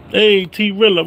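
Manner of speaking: ordinary delivery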